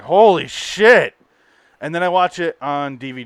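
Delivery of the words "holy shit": slow, louder amazed gasp of words; stunned exclamation. Retold or imitated. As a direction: drag it out in disbelief. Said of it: "And I was like".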